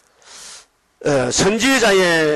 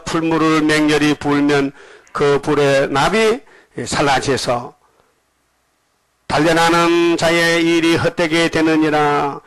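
slow, drawn-out verse recitation; scripture reading; slower than usual. Acted. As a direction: read the verse with long, sustained syllables. Reads the verse aloud. (성경 구절을 느리고 길게 늘여 낭독하듯)

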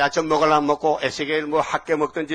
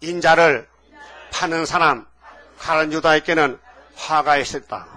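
구절 따라 외치기; 5 dB louder, slower than usual. Shown as a demonstration. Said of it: "따라합시다".

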